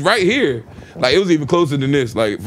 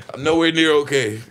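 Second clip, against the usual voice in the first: usual speaking voice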